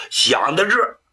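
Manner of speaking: plain reading voice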